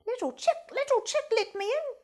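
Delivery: high pleading sing-song; begging fox. A narrator's reading. Fox begging at the brick house, high and pleading. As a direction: plead to be let in. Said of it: "begged the good little fox".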